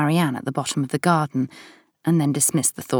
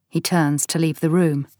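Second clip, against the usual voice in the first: plain reading voice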